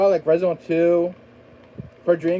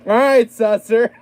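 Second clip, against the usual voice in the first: mock sheriff; 7 semitones higher, 8 dB louder, slower than usual. Joking sheriff address.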